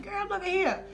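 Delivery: high, loud, imitated amazement; astonished friend. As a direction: urge a friend to look, amazed. Chris exclaims at sight, retold.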